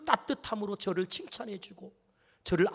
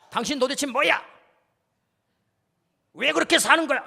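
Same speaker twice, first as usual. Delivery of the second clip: higher, louder accusing jab; imagined accusation; 5 semitones higher, 3 dB louder than usual. Retold or imitated. Husband hearing his wife's question as an accusing, raised jab. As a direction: throw it like a reproach that stings. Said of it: "이렇게 들리는 거예요".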